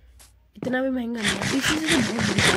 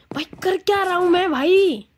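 high, loud self-scolding; exasperated yell; 8 semitones higher, 7 dB louder than usual. Real, not acted. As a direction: cry out in disbelief at yourself. Exasperated at own confusion.

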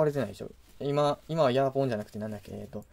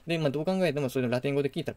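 ordinary talk

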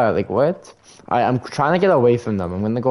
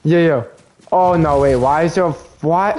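startled; 5 semitones higher, 4 dB louder than usual. Real, not acted. Sudden surprise in game.

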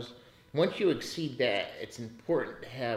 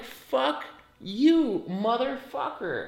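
retold cursing at the bank, pitched much higher and louder; fuck-you retort; 11 semitones higher, 3 dB louder, much slower than usual. Retelling how he cursed out the bank over fees, high indignant mock outburst. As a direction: spit it out like you're telling the bank off. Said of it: "I'm like".

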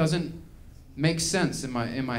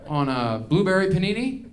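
higher, each item rising like a question; puzzled diner; 4 semitones higher than usual. Acted. Baffled diner inspecting a jazz sandwich, puzzled rising list.

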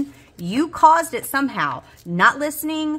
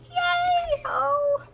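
joyful squeal; much slower than usual. Real, not acted. Cheering about the cash tray.

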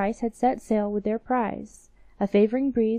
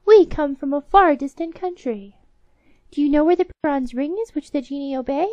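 squeaky mouse voices; 5 semitones higher, 8 dB louder than usual. Acted. Newcomer mice and queen talking.

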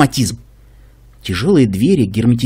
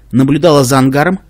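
usual speaking voice

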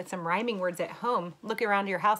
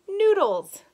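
much higher and louder on the last word; excited punchline; 13 semitones higher, 13 dB louder than usual. Acted. Teacher hitting the silly rhyme punchline for kids, excited. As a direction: land the funny word big.

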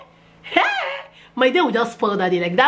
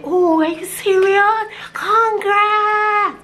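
mock surprise; 12 semitones higher, much slower than usual. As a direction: sarcastically sing out the congrats. Mocking reply to nosy friend.

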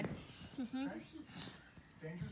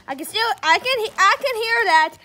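loud arguing; 10 dB louder than usual. Acted. Toy arguing about ears.

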